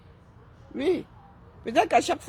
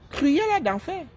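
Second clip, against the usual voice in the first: Cruella d'enfer thrown out higher and emphatic; mock exclamation; 7 semitones higher, slower than usual. Branding a rival influencer a villain, high mocking exclamation.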